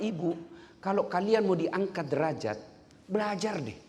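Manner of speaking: ordinary delivery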